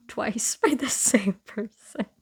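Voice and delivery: laughing through the words. Real, not acted. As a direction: laugh at your own embarrassment while talking. Laughing at own double rejection.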